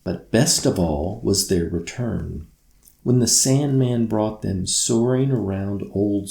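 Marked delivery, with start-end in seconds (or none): none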